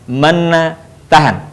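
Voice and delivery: usual speaking voice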